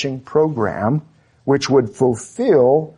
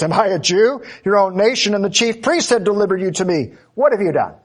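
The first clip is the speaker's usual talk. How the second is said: a touch of higher, brisk scorn; mild derision; 6 semitones higher, faster than usual. Pretend. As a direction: brush the question off with a sneer. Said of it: "asks in derision and scorn".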